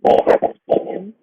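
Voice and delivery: loud stage whisper; whispered lie. A narrator's reading. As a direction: hiss the denial. Animals lie to fox.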